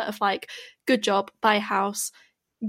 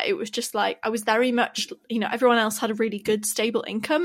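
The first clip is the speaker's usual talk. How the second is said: ordinary talk, nothing marked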